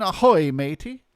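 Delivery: slow, hammed-up pirate drawl sliding into Irish; cheesy pirate that drifts Irish. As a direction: play a jolly pirate captain hailing the crew, loud on ahoy. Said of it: "I always go Irish when I try to go pirate".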